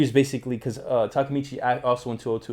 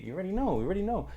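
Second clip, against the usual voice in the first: sing-song catchphrase; 9 semitones higher, faster than usual. Real, not acted. Playful catchphrase repeated.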